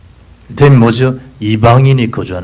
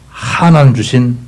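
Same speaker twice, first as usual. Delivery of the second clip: usual speaking voice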